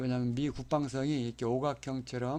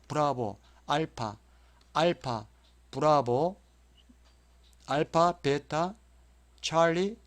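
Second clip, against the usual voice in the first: 브라보, 알파, 베타, 찰리 punched on the first syllable; front stress; 3 semitones higher, 7 dB louder, slower than usual. Shown as a demonstration. Teacher demonstrating code-word stress, first syllable of 알파 punched. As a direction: hit the opening syllable of 알파 and 찰리 louder. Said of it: "강세가 있습니다".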